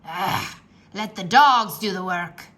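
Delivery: gruff pirate growl; pirate captain growls at his crew. A narrator's reading. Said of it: "growled Captain Bones".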